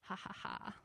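low, quiet mock chuckle; imitated soft laugh. God amused at what's in store, imitated soft laugh. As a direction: chuckle softly like an amused father. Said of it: "like, laughing. He's like".

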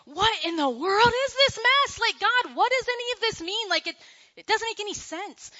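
hammed-up exasperated old king; exasperated Solomon. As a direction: throw up your hands at a senseless life. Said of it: "throwing up his hands and looking over his life".

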